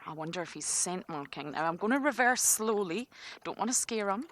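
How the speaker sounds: normal talking voice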